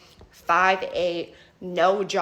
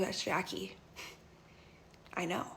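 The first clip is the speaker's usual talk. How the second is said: much softer, resigned admission; quiet resignation; 3 semitones lower, 14 dB quieter than usual. Retold or imitated. Heartbroken friend admitting she knew, much softer. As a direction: say it as if already defeated, much softer. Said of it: "She's just quiet".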